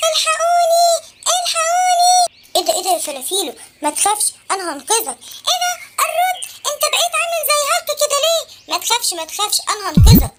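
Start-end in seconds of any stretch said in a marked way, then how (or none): 2.5-5.2 much lower, strong hero; deep hero voice. Acted. Transformed kid comes to rescue his friend, deep. 8.7-10.3 much lower, strong hero; deep hero voice. Acted. Transformed kid comes to rescue his friend, deep.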